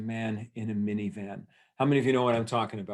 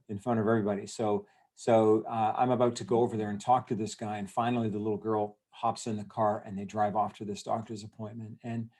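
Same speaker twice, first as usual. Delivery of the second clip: usual speaking voice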